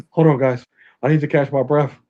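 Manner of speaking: imitating a wheezing, winded man; gasping mock plea for breath; breathless old person. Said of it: "he can't breathe".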